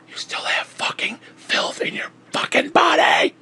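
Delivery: raspy, breathy shout; strained shout. Real, not acted. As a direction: force it out in a harsh strained yell. Angry rant climax.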